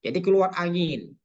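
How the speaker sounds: ordinary talk, nothing marked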